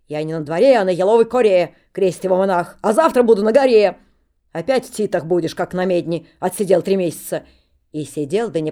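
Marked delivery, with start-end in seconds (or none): 0.1-4.0 holy fool answering the steward in rhyme; loud rhyming retort. Acted. Monk rhyming back defiantly. 4.5-7.4 loud reproach; stern rebuke. Acted. Steward scolding.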